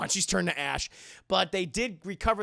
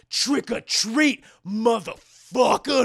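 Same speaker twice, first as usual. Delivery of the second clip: slow, loud action-hero line; slow tough one-liner; 2 semitones higher, 8 dB louder, slower than usual. Retold or imitated. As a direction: drag out the taunt like a movie badass. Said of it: "one thing to say to Michael Myers".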